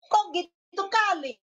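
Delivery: high, drawn-out Batak exclamation; Batak manner. Shown as a demonstration. Preacher imitating a Batak's blunt retort, high Batak manner. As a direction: protest in Batak style, seemingly angry. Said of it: "bukan berarti dia lagi marah".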